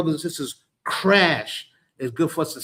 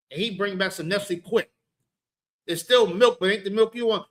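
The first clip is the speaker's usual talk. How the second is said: usual speaking voice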